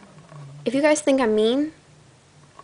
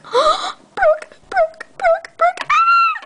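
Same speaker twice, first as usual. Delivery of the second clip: very high squeal of delight, louder; squealing fan; 4 dB louder, much slower than usual. Pretend. Fan spots the rare toy in the aisle, shrieking with joy. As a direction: shriek like a thrilled fangirl. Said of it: "So you're like".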